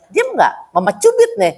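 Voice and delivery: acted scolding mother, pitch starting high then dropping; mild mock threat; mock threatening scold. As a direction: warn a crying child off, half in play. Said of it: "kalau kita bentak tambah nangis".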